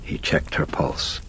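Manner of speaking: plain reading voice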